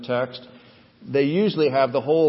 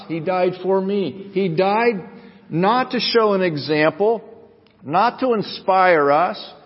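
higher, emphatic pulpit delivery; fervent preaching; 5 semitones higher, 4 dB louder than usual. Real, not acted. Preacher tells congregation why Jesus died, fervent preaching. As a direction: drive each point home to the congregation.

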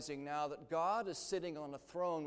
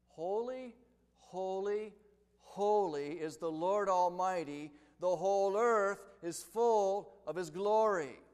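proclaiming cry; 5 semitones higher, 10 dB louder, slower than usual. Acted. Seraphim's cry read aloud.

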